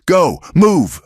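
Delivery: high, loud shouted commands; shouting. A narrator's reading. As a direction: drive the horse out. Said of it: "shouting over the roar of the fire".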